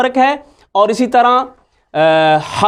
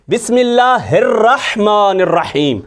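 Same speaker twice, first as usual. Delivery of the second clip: recitation. Shown as a demonstration. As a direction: recite it as in prayer, carefully. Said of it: "तो ये है इन हरूफ में फ़र्क".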